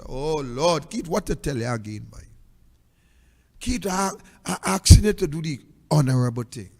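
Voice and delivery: quiet weary plea. Real, not acted. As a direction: beg him quietly, drained of fight. Pleading quietly, wrapping up the rant.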